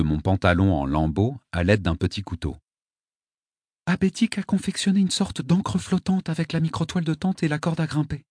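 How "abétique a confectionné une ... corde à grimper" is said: lightly voiced high young girl. A narrator's reading. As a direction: explain calmly as a girl. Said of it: "me dit-elle".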